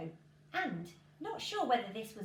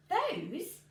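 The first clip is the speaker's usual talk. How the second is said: doubtful question; 8 semitones higher, 5 dB louder than usual. Acted. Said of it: "asked Jack".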